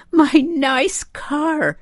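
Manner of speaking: high, slow, drawn-out wail; mother's high, slow lament; tearful wail. A narrator's reading. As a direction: stretch it out as if crying. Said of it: "she began to cry".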